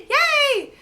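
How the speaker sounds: high, loud little cheer; excited cheer. Real, not acted. Cheering at praise.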